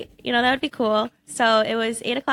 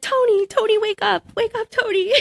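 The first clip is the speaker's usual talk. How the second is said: much higher, excited calling of Tony; urgent wake-up call; 11 semitones higher than usual. Retold or imitated. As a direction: shake him awake with joy. Shaking brother awake with news.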